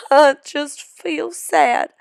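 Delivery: a touch of tears; sobbing. Acted. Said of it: "sobbed Bill".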